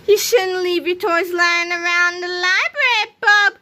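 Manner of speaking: high, sing-out sly taunt. A narrator's reading. George taunting Melvin as Harold takes aim, sly. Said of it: "George said slyly".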